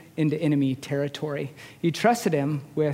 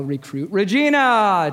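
slow barked call, louder and higher; drill instructor yell; 4 semitones higher, 5 dB louder than usual. Retold or imitated. Drill instructor summoning a recruit, slow loud bark. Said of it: "come out and yell".

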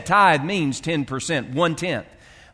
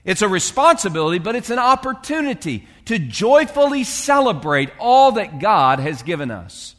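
rising preaching fervour; 5 semitones higher, 3 dB louder than usual. Real, not acted. Preacher building to climax.

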